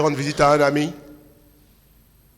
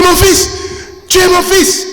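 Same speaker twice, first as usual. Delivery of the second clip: much higher, louder overjoyed exclamation; father's cry; 8 dB louder, slower than usual. Retold or imitated. Father recognising his grown son, crying out high and loud. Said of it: "Il dit".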